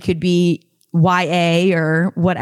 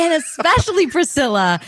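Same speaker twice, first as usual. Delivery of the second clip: higher, bright echo of the greeting; host greeting; 6 semitones higher than usual. Shown as a demonstration. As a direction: repeat it as a host. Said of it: "start greeting Priscilla".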